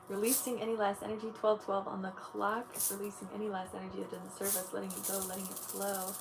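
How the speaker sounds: soft, quiet meditation voice guiding through each chakra; guided meditation. Acted.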